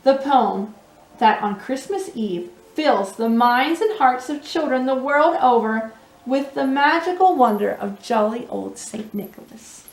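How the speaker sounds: usual speaking voice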